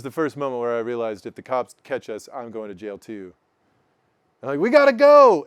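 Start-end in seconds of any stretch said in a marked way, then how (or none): 4.6-5.4 sudden loud, high urgent call; urgent retold alarm. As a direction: blurt it in panic. Panic as cop car passes.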